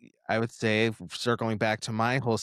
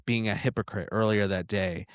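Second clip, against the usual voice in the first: ordinary talk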